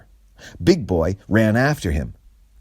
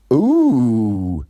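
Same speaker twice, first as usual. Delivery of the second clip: high, louder dim-witted ooh; Big Boy voice; 5 semitones higher, 6 dB louder than usual. A narrator's reading. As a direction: realise it slowly, eager sidekick. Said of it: "said Big Boy".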